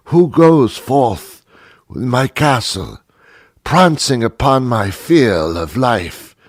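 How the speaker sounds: hammed-up British Shakespearean actor; mock British accent. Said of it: "They have this British accent".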